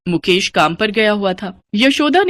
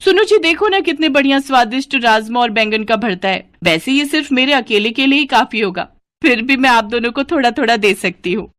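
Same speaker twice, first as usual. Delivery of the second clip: higher, gushing and dismissive; cheeky greedy daughter-in-law; 4 semitones higher than usual. Acted. Sunita to her mother-in-law over the snacks, gushing and cheeky. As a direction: brush off the scolding with a full mouth of glee.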